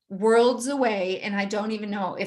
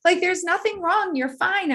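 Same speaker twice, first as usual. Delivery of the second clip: much higher, lightly laughing reassurance; mock the husband calming her down; husband urging calm, pitched up and amused; soothing husband; 9 semitones higher, 3 dB louder, slower than usual. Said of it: "he'd be like".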